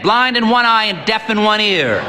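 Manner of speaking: put-on high, booming old priest; hearty old priest. A narrator's reading. Old priest sizing up the sick boy, hearty. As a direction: boom at the boy like a brisk priest.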